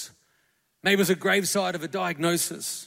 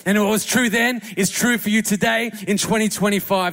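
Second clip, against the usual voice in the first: loud, ringing pulpit proclamation; full-force preaching; 6 dB louder than usual. Acted. Preacher proclaiming resurrection to the Easter congregation, loud and fervent. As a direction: preach it out to the whole room.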